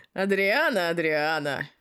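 put-on slurred drunk calling out. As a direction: call her name like a drunk. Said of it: "пьяным голосом проговорил".

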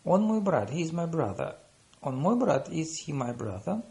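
ordinary delivery

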